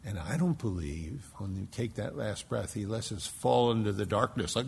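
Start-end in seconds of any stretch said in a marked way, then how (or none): none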